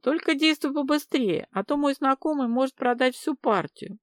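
high, cheerful urging. A narrator's reading. Людмила hurrying her caller along, cheerful. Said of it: "весело откликнулась Людмила".

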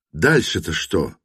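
ordinary delivery

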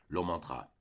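ordinary delivery

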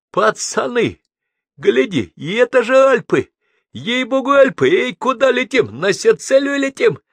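very high, loud, amazed shouting; excited yelling. A narrator's reading. Madman at the window shouting about the Alps, excited yelling. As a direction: yell to the lads in wild excitement. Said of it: "обернулся и заорал".

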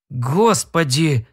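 slow despairing moan; groaning. A narrator's reading. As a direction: drag it out as a groan. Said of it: "простонал Симс". (стон отчаяния)

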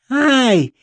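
пронзительно высокий короткий визг; взвизгни, как капризная девочка. A narrator's reading. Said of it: "взвизгнула баронесочка". (капризный высокий взвизг)

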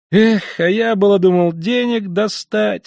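high, slowed, loud sigh of letdown; disappointed exclaim. A narrator's reading. Young man cries out, let down, at the locked door. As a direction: cry out in disappointment. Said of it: "вскричал молодой человек".

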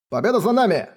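high, proud announcement; self-important. Acted. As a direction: declare it pompously. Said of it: "важно сказал фехтовальщик".

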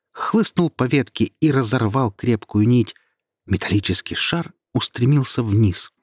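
plain reading voice

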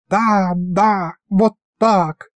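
high sensual purring voice. Acted. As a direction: relish every word. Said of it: "сладострастно шептала она".

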